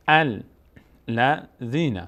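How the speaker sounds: الذین broken slowly into al, la, dhīna; syllable by syllable. Shown as a demonstration. Teacher teaching the word, slow syllable-by-syllable reading. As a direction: sound out الذین with clear gaps between syllables.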